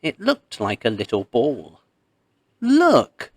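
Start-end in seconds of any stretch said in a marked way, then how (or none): 2.6-3.3 sudden high, loud exclaim; a child spotting something amazing; excited cry. A narrator's reading. Child calling to the people, excited high cry. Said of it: "cried the child".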